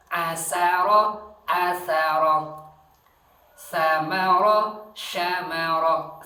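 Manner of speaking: asara, samara, syamara drawn out slow and loud; slow pronunciation drill. Shown as a demonstration. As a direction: stretch each word out loud to show the sound. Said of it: "beda antara sa dengan sa". (pelafalan lambat dan keras)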